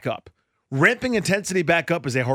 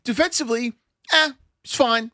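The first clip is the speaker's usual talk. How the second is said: retelling his own radio answer, higher; his own radio answer, voiced much higher; quoting himself; 10 semitones higher than usual. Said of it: "And I said".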